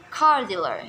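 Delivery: car dealer with CAR stressed; car dealer, weight on the first word. Shown as a demonstration. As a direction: hit CAR, let dealer fall. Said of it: "stress lies in the first word".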